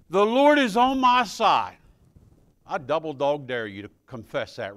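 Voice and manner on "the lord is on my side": loud, high proclamation; preaching a verse. Acted. Preacher proclaims the verse to the church, loud. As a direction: call it out boldly.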